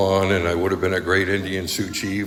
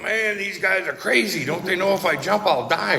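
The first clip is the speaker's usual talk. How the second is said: mock disbelief; 10 semitones higher than usual. Voices his own thought on bridge.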